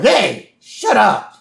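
high, sudden mock yell; mock outburst. Host retelling Homer telling them off, mock-angry. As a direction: cut them off with a cartoonish shout. Voicing Homer snapping back.

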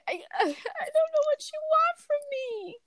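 very high, squeaky mock despair; helpless squeal. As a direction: whine it helplessly in a tiny high voice. Acted helplessness with customer.

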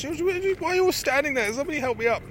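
high, imitating the injured girl's outrage; shrill-free but high mock complaint from the floor; indignant fallen woman. Fallen girl scolding the crowd of bystanders, high mock outrage. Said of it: "She goes".

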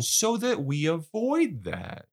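high, drawn-out friendly advice. Retold or imitated. Dead friend reasoning with his buddy, friendly. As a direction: imitate a pal putting it delicately. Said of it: "speaking to him like a friend".

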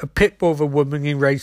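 ordinary delivery, nothing marked

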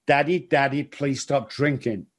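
ordinary delivery

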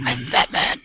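low gravelly Batman growl. Retold or imitated.

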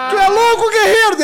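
high, loud incredulous bark; officer's shout. Retold or imitated. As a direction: yell it in disbelief at the recruit. Tenente grita com o soldado. (tenente gritando com o recruta)

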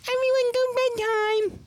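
high-pitched put-on bedtime call; hammed-up high bedtime order; mock parent at bedtime. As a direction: imitate a parent calling out, way up high. Voices parent ordering kids to bed.

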